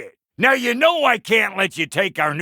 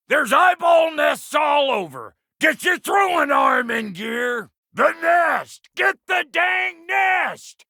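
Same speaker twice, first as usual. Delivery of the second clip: shouting at the companion mid-battle, frantic; urgent shouting; 8 semitones higher than usual. Acted. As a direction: holler orders over the fight. Frantic combat calls at eyeball nests.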